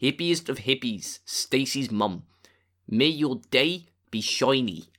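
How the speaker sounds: put-on South African accent. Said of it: "This one is South African".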